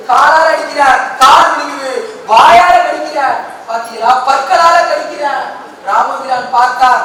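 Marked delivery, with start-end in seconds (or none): 0.1-3.2 loud, rising battle narration; booming storyteller. A narrator's reading. Storyteller booming Kumbhakarna's battle to the crowd. Dramatic Ramayana discourse, fighting Kumbhakarna. 4.3-5.2 loud, high telling of the fight; booming storyteller. A narrator's reading. Storyteller shouting the giant's rampage to listeners.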